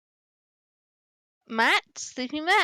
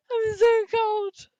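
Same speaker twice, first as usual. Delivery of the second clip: whiny cry; 9 semitones higher, slower than usual. Real, not acted. Whining about the cold.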